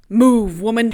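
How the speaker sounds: loud, curt order to move aside; loud, impatient shove of a command; gruff command. Retold or imitated. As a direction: bark it like a man in charge. Finnick shoving Katniss aside.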